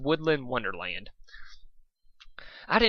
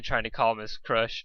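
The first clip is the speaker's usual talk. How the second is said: ordinary delivery, nothing marked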